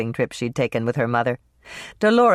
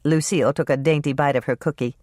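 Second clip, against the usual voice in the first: ordinary delivery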